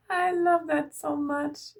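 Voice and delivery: high, gushing, just after a laugh; real tickled delight, pitched well up. Real, not acted. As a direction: gush it through leftover laughter. Laughing delight at the card's message.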